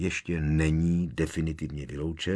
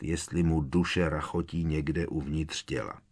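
plain reading voice

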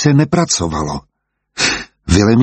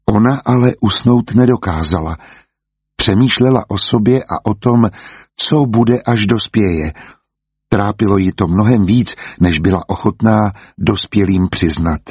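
ordinary delivery

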